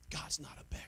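hushed whisper for emphasis. Real, not acted. Preacher whispering that God is no beggar. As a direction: drop to a whisper to drive it home.

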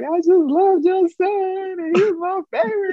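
high, drawn-out mock wail; retold crying. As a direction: act out blubbering to your idol's mother. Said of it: "I was just crying".

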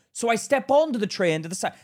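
normal talking voice

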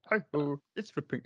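high, soft puppet frog attempt; Kermit. Co-host attempting the requested line, Kermit voice. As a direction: try the frog puppet voice, a bit unsure of the line. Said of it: "Can you say it's".

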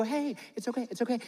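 acted gentle comfort, voice dropped in volume; soothing parent. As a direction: hush and comfort a flustered child. Acts parent soothing daughter after spill.

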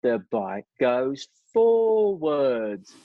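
hammed-up [name] driving the punchline to a shrill peak; American boss mocking the engine in front of the factory; exaggerated American accent. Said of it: "I won't do the accent 'cause I'm crap at accents".

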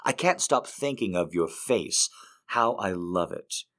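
soft, unhurried love poem reading. Acted. Reads a second poem.

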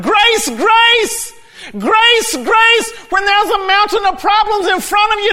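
high, shouted sermon climax; full-force preaching. Acted. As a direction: build to a loud, fervent proclamation.

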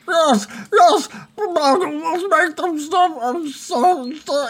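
high-pitched, blubbering surrender; hammed-up sobbing goblin; weeping. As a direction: wail the apology in a put-on squeal. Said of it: "And he's weeping".

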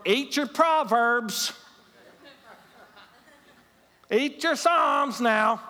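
high, loud, drawn-out scolding order; mock commanding Lord. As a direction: bellow it slowly like a nagging command from above. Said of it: "have the Lord going".

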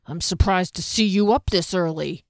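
growly-voiced sarcasm; sarcastic jab in a character voice. A narrator's reading. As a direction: needle them with false surprise. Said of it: "he said sarcastically".

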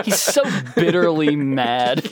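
slow words through laughter; laughing. Real, not acted. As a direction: laugh while saying it. Laughing at the song.

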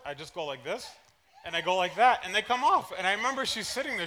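normal talking voice

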